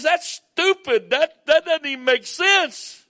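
high, loud mocking scorn; mock outrage from a scoffing crowd; scornful world. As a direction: sneer it like the world would. Preacher mocks the world's scorn.

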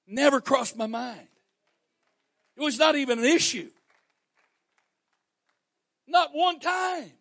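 high, loud, emphatic preaching; full-force preaching. Acted. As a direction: hammer home the point to the congregation.

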